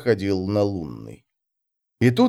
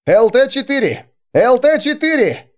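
much higher and louder radio shout; 13 semitones higher, 6 dB louder than usual. Acted. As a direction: yell the call sign urgently. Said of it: "кричал радист".

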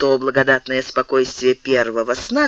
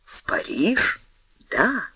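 whispered echo; 5 semitones higher, 5 dB quieter, slower than usual. A narrator's reading. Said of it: "Портос подскочил".

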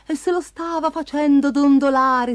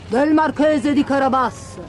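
lightly voiced lone villager, falling tune; humble peasant's reply; 3 semitones higher than usual. A narrator's reading. Bowing man answers the king, a hint of deference. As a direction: answer plainly as one man bowing to royalty. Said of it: "rispose l'uomo inchinandosi profondamente".

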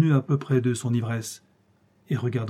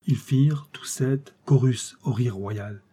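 ordinary delivery, nothing marked

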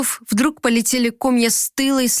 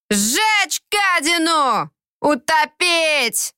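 put-on slow, high drunken roar; town drunk; 7 semitones higher, much slower than usual. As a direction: bellow it like a drunk in a mob. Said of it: "взревел пьяный голос".

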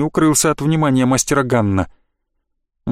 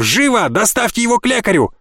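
гневный приказ; 7 semitones higher than usual. Acted. Keeps shouting at guards.

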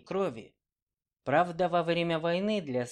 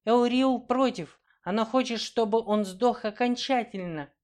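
elf woman voice; 9 semitones higher, 6 dB louder than usual. Acted. Girls voicing the elf.

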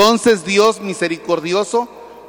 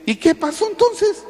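surprised priest; 14 semitones higher than usual. Retold or imitated. Priest asks the penitent.

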